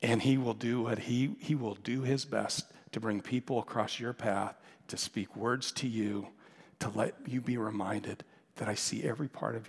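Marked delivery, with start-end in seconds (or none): none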